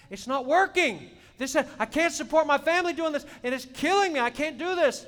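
loud, high, anguished pleading; desperate cry. Retold or imitated. Preacher reliving his desperate cry to God.

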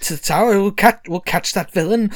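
a touch of a pompous hero promising protection. Acted. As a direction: puff up like a brave guardian. Quill still voiced.

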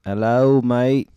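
loud Liverpool English greeting; English accent. Pretend. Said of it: "I got [name] in the house".

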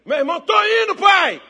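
high, loud acted yell; shouted scrambling reply. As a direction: call back urgently to an angry dad. Brother hurriedly answers shouting father.

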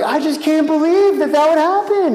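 high, mock-astonished whine of the naive people; mocking shocked people. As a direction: mimic their disbelief in a high silly voice. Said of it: "They're like".

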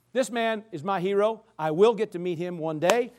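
ordinary talk, nothing marked

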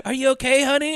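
high, loud mock concern; shirtless paperboy. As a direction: act out a kid playing the sweetheart. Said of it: "shirtless paper boy pops up next to her and goes".

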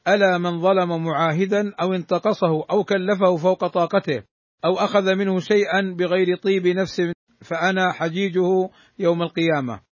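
usual speaking voice

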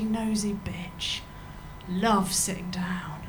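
slow mock whisper. Imagined receptionist whispering her career choice. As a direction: whisper it slowly, sly and smug.

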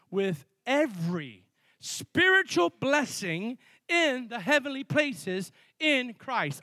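slow, deliberate scripture reading; unhurried reading of the verse; read slowly. Shown as a demonstration. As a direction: weigh every word, slowly. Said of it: "I want to read this slow".

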